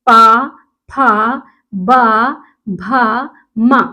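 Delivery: punchy loud पा, then slow फा बा भा मा; teacher demonstrating labial consonants; lip syllables. Shown as a demonstration. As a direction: pop the lips on each syllable. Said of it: "हम बोल के देखते हैं".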